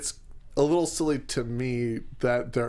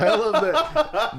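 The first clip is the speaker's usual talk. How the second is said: laughing; 12 semitones higher, 5 dB louder, slower than usual. Real, not acted. Mocking the odd name.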